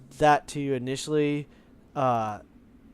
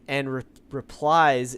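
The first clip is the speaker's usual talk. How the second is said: ordinary delivery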